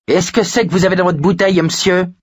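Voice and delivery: put-on high, quick child's question; boy calling out. A narrator's reading. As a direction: fire it off fast and high. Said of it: "lui lança Richie d'où il se tenait". (gamin qui lance sa question, aigu et rapide)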